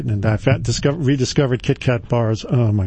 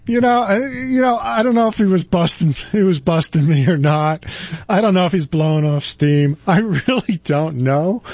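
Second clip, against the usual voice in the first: laughing; 6 semitones higher than usual. Real, not acted. Still laughing after story.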